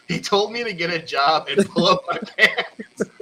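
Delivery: slow, laughing into the words; laughing through it. Real, not acted. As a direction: read it while giggling. Host reads joke complaint.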